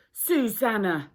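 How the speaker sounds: sharp, loud angry shout of her name; angry call. A narrator's reading. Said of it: "Andrew sounded angry".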